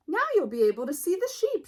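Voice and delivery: high, fast and bright; eager sheep. Acted. Sheep shows Sheepdog his new hair bow, high and quick. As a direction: rush it out with glee. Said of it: "she said".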